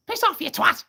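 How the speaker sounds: very high parrot screech; sweary parrot. Acted. Parrot answering back after the fridge, high squawk. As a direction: squawk the insult shrilly. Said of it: "the parrot says".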